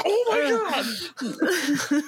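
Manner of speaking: very high, slow amazed oh my god; stunned oh my god. Retold or imitated. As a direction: stretch it out in disbelief. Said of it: "that we're like".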